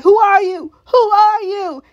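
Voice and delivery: high, loud mock demand; shouted challenge. Boyd with weapon out at Elgin, mock shouted challenge. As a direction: imitate an armed man challenging a stranger.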